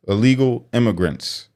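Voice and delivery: ordinary talk, nothing marked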